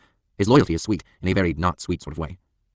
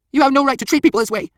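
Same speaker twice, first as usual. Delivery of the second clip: very high, loud, wailing indignation; outraged protest; 8 dB louder than usual. A narrator's reading. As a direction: protest hysterically at the attackers. Humiliated fat man protests.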